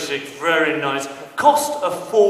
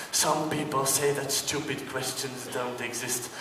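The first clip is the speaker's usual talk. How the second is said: normal talking voice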